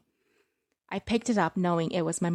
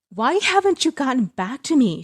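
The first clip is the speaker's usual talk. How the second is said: high, loud demanding cry; mother crying out; 7 semitones higher, 9 dB louder than usual. Acted. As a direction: snap the question at your daughter, loud and high. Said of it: "she cried".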